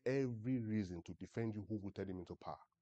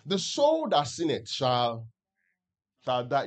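drawn-out, louder reading of the verse, each word weighted; slow scripture proclamation; 2 semitones higher, 11 dB louder, slower than usual. Acted. Preacher to congregation, slow solemn scripture proclamation. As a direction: proclaim the verse slowly to the church.

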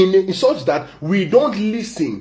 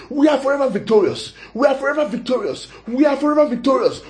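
higher, faster victorious cry; rising triumphant proclamation; 7 semitones higher, faster than usual. Real, not acted. Repeated declaration in sermon.

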